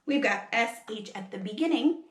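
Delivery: plain reading voice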